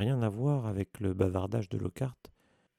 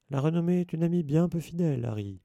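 higher and louder, lofty proclamation; pompous maxim; 7 semitones higher, 4 dB louder than usual. Acted. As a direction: declaim it like great wisdom.

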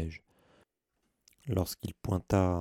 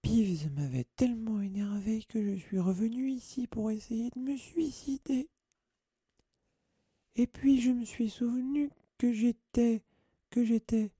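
Mimi ghost voice; 14 semitones higher than usual. Acted. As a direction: drag out the words like a tragic little ghost. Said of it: "dit Mimi d'un ton grave".

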